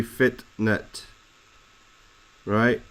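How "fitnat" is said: "fitnat" split in two, fit-nat, with the T heard; final T sounded. Shown as a demonstration. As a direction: break "fitnat" before -nat and close on T. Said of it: "We don't say fitnat".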